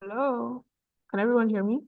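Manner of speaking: ordinary delivery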